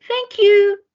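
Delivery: very high, loud yappy thanks; a delighted little dog; grateful dog. A narrator's reading. The dog thanks George for the belt path, high and loud.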